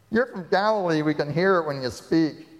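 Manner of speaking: accusing crowd. Retold or imitated. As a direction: point the finger at him. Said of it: "and they say".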